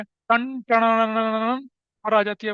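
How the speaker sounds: slow, drawn-out dramatic TV serial sound; dramatic serial sting. Retold or imitated. Narrator mimics a soap opera sting, slow and drawn out. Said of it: "Ekta Kapoor के serial की तरह आती है".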